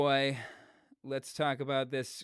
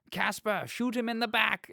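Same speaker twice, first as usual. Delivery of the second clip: high, raised villain's order; mock scream; 10 semitones higher, 5 dB louder than usual. Retelling Oswald ordering Caspar to shoot, mock scream. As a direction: call out the command in a mock yell. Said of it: "Oswald screams out".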